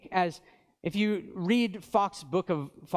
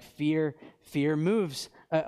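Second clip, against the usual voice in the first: normal talking voice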